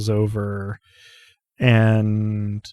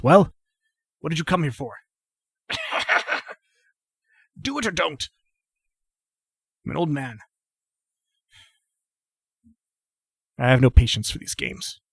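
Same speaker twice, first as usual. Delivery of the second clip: put-on higher, testy old-man voice broken by coughing; impatient old man; 6 semitones higher, faster than usual. Old man on litter challenges his bearers, high and impatient. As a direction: challenge them to get it over with. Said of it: "glares at Romnet and he says".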